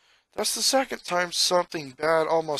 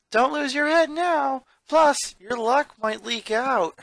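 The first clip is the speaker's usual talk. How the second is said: lightly voiced playful clown girl, higher; Luann, teasing; 10 semitones higher, 4 dB louder than usual. A narrator's reading. Luann teasing Lincoln about his luck, playful. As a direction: tease him with a grin in the voice. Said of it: "she chuckled again".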